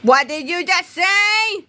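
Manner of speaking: high, slow, outraged near-scream; a secretary's fury boiling over; rising to a scream. A narrator's reading. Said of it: "in a voice that was rapidly becoming a scream".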